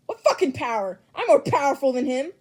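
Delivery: loud indignant snapping; angry shout. Acted. Bakugo angrily denies Deku's power.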